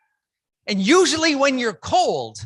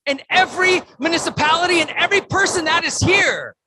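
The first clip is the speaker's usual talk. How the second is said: rousing call; 6 semitones higher, 4 dB louder than usual. Real, not acted. Rallying the crowd from stage.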